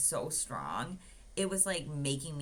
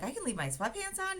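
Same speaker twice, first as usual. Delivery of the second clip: much higher, eager little question; hopeful surprise. Retold or imitated. Patient asking the nurse about sweatpants, high and hopeful. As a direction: sound delighted it might be allowed.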